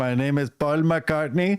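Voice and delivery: slow, high mock Indian introduction; drawn-out put-on Indian accent; hammed-up Indian caller introducing himself. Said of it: "doing the Indian voice".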